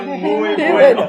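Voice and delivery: high, loud, drawn-out amused reaction; laughing. Real, not acted. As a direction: say it through real laughter. (entre carcajadas)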